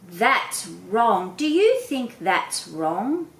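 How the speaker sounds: "wrong" stressed twice, the question slow and rising; modelling repetition. Shown as a demonstration. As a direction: repeat the word back to a child slowly. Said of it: "I repeated this word".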